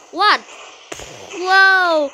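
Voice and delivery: normal talking voice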